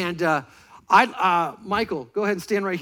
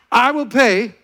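loud oath; 6 semitones higher, 11 dB louder, slower than usual. Shown as a demonstration. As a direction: belt it out, high and loud. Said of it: "say it nice and loud".

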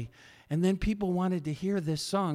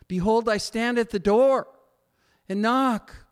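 raised, loud preaching voice; preacher proclaiming Jesus's words louder; preaching loudly; 6 semitones higher, 7 dB louder than usual. Acted. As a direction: ring it out like a call from the pulpit. Preaching scripture climax.

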